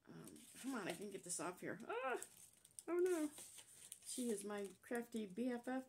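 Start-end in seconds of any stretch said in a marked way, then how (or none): 1.9-3.3 sudden high cry, then dismay; real alarm, higher pitch; startled yelp. Real, not acted. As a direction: caught off guard as it sticks. Sticker tears while peeling.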